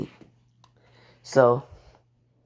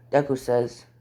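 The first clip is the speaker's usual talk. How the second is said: plain reading voice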